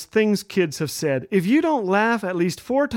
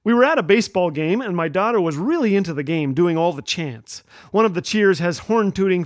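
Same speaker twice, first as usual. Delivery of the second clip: ordinary talk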